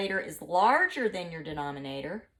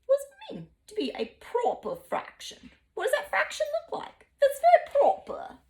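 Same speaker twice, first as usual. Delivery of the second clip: high, prim British voice; mock upper-class British accent; posh British; 13 semitones higher than usual. Shown as a demonstration. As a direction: ask it like a prim British lady. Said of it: "your British accent and your pinkies are out".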